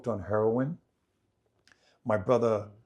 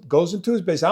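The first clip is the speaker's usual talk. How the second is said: ordinary delivery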